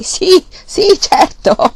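put-on high, loud cackling old man; old grandfather chuckling with delight; clucking voice. A narrator's reading. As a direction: cluck it out, laughing. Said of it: "fece con voce chiocciante".

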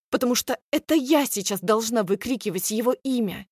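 ordinary delivery, nothing marked

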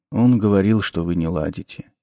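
low, soft, dark necromancer voice; sinister professor. A narrator's reading. Necromancer introducing himself to the nervous teacher, low and soft. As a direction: speak low and soft with quiet menace.

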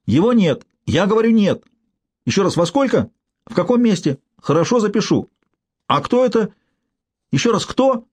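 high, strained yelling down a bad line; shouting into the phone. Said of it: "заорал он в трубку".

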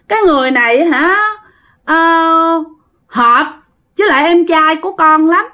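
put-on high, pleading ghost-child voice; whiny little child. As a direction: beg grandpa like a small child, pitched high. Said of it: "nói theo cái kiểu nhõng nhẽo". (giọng hồn ma con nít năn nỉ, nhõng nhẽo, cao)

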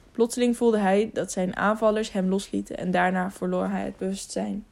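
ordinary delivery

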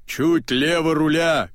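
high, slow called order; крик. A narrator's reading. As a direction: call the helm order out to her. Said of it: "кричит он". (громкий крик с палубы)

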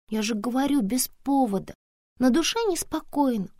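put-on high-pitched, plaintive child; little girl, uneasy. Daughter explaining her unease to mama, plaintively.

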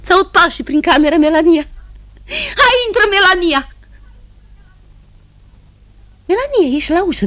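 high, eager call; excited calling. Acted. Woman hearing her sister's steps, eager call. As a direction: greet her with rising excitement.

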